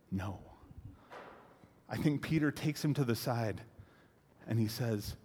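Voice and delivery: normal talking voice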